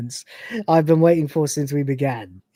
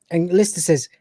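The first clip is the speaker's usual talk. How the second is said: usual speaking voice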